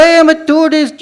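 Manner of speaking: high, loud put-on Mexican accent; playful fake Mexican tourist accent; Speedy Gonzalez accent. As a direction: pass yourself off as a Mexican tourist. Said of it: "decided to do my Speedy Gonzalez accent".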